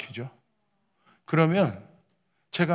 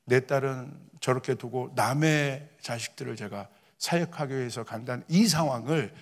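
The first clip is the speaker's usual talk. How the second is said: normal talking voice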